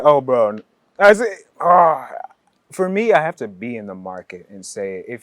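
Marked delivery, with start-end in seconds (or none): 0.0-2.1 Ghanaian accent. Shown as a demonstration. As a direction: call out like a local in the market. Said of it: "for me, it's like".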